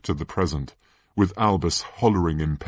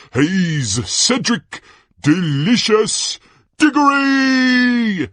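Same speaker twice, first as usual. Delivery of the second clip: slow, teasing build to a high shout; Ludo Bagman, gushing announcer; 10 semitones higher, 6 dB louder, slower than usual. Acted. Announcer presenting the favourite Hogwarts champion, gushing and showy. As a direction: tease the crowd, then bellow the name.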